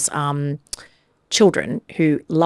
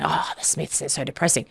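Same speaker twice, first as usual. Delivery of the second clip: softer, low-energy imitation of a moaner; mock gloomy complaint; 3 semitones lower, 8 dB quieter than usual. Podcaster imitating everyone's complaint about the [name], mock gloomy. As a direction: groan it quietly, imitating a downer.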